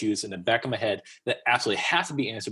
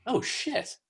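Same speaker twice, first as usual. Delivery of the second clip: much higher, stunned "oh, shit"; sudden alarmed surprise, played for laughs; shocked; 14 semitones higher, 4 dB quieter than usual. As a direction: caught completely off guard. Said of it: "I'm like".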